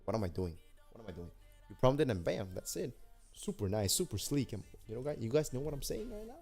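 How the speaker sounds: ordinary talk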